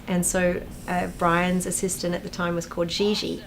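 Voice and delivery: ordinary talk, nothing marked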